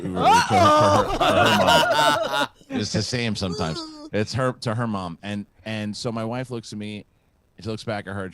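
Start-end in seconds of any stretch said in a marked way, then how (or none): 0.0-2.5 high, loud blurt through laughter; loud self-correction. Real, not acted. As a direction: correct yourself in a high-pitched holler.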